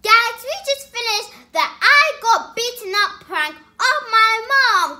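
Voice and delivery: high, loud, hyped-up intro; excited announcement. Real, not acted. Child telling viewers the prank is finished, exuberant shout. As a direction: belt it out to the viewers.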